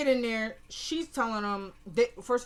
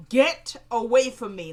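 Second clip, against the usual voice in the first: retold scream; 7 dB louder than usual. Candace rebuffs the man pleading with her, retold scream. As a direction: yell it as if retelling a scream. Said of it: "Like, she screams it".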